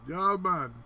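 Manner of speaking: jokey fake Jamaican accent; mock Jamaican accent.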